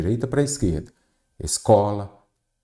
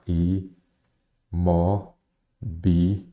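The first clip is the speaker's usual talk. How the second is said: "i", "mo", "bi" as separate beats with long pauses; syllable by syllable; 3 semitones lower, much slower than usual. Shown as a demonstration. Teacher dividing imobiliário for students, syllable by syllable. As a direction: chop out "i, mo, bi" one syllable at a time. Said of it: "já separa em sílaba".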